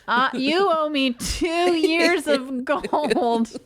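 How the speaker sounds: put-on slow, lightly childish voice; a hint of a three-year-old, drawn out; imitating a kid. Mother imitating her toddler's demand, slow and light. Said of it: "They would be like".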